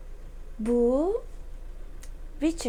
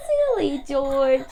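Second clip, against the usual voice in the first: loud, slow, drawn-out storybook teasing; mock amusement; 2 semitones higher, 6 dB louder than usual. A narrator's reading. Peppa teasing George, loud mild amusement. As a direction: draw it out as if amused. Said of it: "diye gülüyor Peppa".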